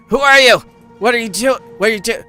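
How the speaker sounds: high, loud startled questions. Acted. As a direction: blurt it out in surprise. GM voices startled Leshies.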